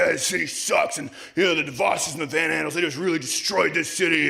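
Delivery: a hint of a sulky young socialist; imitating complainer. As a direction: mimic the guy griping about the city. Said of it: "He's like".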